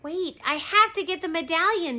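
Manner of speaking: high-pitched insistent call; shout. A narrator's reading. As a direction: call it out urgently. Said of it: "shouted Jack".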